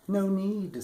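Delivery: quick high little man's reply; a hint of a strange little man cutting in. A narrator's reading. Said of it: "said the man".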